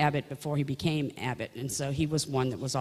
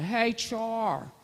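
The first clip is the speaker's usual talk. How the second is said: put-on higher Pennsylvania accent greeting; 7 semitones higher than usual. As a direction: imitate the cousin's Pennsylvania hello. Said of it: "he's got that Pennsylvania accent".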